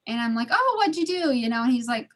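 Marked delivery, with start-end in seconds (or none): 0.5-1.3 cheery question. Retold or imitated. Cheerful wife questioning husband.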